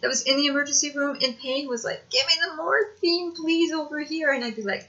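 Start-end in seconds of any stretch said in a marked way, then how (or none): 2.1-4.3 raised, higher pleading call; mock begging patients. Other patients in the ER calling out, mock pleading. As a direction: imitate patients calling for the drug.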